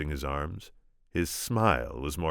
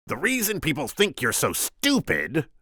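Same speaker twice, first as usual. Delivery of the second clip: high, loud Vizzini voice; squeaky scheming Sicilian; 12 semitones higher, 4 dB louder than usual. Acted. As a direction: pitch him up, sharp and cocky.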